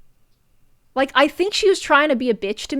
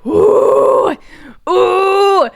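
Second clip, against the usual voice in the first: mock anguished howl; mock wailing ooh; 7 semitones higher, 6 dB louder than usual. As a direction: wail it like a cartoon villain in agony. Mock villain wail at rival.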